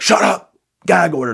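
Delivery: loud, high imitation of a yelling dad; dad's yell. Dad silencing crying kids in the back, mock yell. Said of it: "my dad would just be like".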